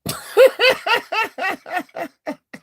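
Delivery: high, loud burst of laughter. Real, not acted. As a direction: crack up at her boasting. Laughs at her claims.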